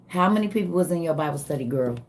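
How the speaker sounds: ordinary talk, nothing marked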